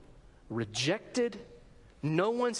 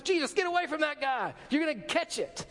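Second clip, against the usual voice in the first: higher, mock-alarmed warning; alarmed disciples; 7 semitones higher than usual. Disciples warning Jesus away from the leper, mock alarm. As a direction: urgently pull him back from danger. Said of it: "Can't you see Peter and the disciples?".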